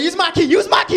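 loud, high impatient yell; impatient shout. Retold or imitated. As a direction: hurry him with a shout. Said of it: "He's like".

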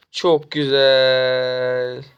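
"Çok güzel" stretched very slowly, savoring each word; drawn-out delight. Real, not acted. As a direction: really savor "Çok", drag it out. Savoring tower kill in game.

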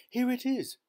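excited cry. A narrator's reading. Wife finding the lost box, excited cry. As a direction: burst out on finding it. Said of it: "she cried".

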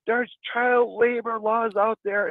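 retold yelling. Old guy telling off the grandpa, mock yelling. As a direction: imitate an old guy protesting, slow and high. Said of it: "starts yelling at my grandpa".

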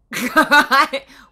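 a big burst of laughter; loud cackle at the dribble joke. Real, not acted. Laughs at own sweats joke.

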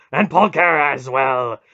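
wrathful god promising torment; snarling rage. A narrator's reading. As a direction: thunder out each threat in fury. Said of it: "Mara snarled".

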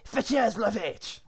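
loud, slow mock French order; French command. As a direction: order your men in hammed-up French. Guard orders the cow fetched.

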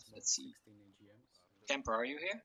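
ordinary delivery

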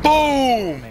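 high, loud, drawn-out boom; triumphant shout. Real, not acted. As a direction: shout it out in victory. Celebrates a kill.

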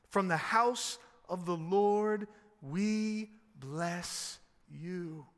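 slow, drawn-out solemn reading; scripture proclamation. Acted. Preacher reading the psalm to the congregation, slow proclamation. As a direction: proclaim each phrase slowly, with weight.

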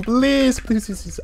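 high, squealed begging; pleading. Real, not acted. Player begging not to be bad at the game, pleading.

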